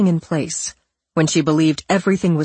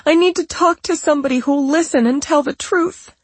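high, fast, frightened voice; fake scared voice; 12 semitones higher than usual. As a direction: rush it out, terrified. Patricia's faked panic.

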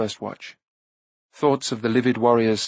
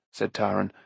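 ordinary delivery, nothing marked

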